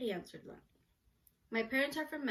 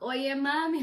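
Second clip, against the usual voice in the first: loud, raised mock Cuban accent; 4 semitones higher, 9 dB louder than usual. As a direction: call it out with a playful Cuban accent. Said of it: "love their accent".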